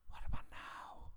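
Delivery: unvoiced whisper. Real, not acted. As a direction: whisper into the mic. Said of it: "I have to whisper".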